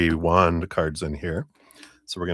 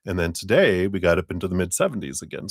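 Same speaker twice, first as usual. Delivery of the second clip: normal talking voice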